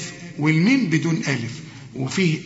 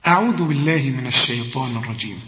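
Quran recitation; 4 semitones lower than usual. Shown as a demonstration. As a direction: recite it with care. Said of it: "خد بالك". (تلاوة مجودة هادئة)

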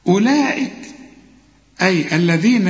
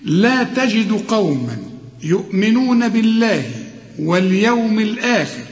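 chanted tajweed recitation, higher and drawn out; Quran recitation; 5 semitones higher, slower than usual. Shown as a demonstration. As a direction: intone the verse slowly. Reciting the verse.

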